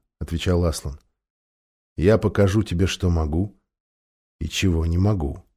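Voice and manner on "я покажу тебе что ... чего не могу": low, soft, grave voice; Aslan, gentle gravity. A narrator's reading. Great lion answering the tearful girl, gently grave. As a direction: answer quietly, pitched well down.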